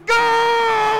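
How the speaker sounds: goal yell. Real, not acted. Celebrating a goal in game.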